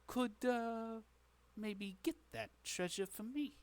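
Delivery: lightly voiced shady patron pitching a job; a hint of a wheeler-dealer; scheming tavern boss. Game master plays treasure-seeking boss.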